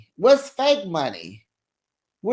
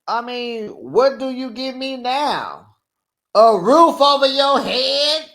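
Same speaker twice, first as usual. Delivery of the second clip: slow, higher and louder mock sarcasm; mocking sarcastic girlfriend; 8 semitones higher, 5 dB louder than usual. Girlfriend mocking Julius about what he gives her, slow and sarcastic. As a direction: drag it out like she's throwing it in his face. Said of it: "retelling the couple's argument".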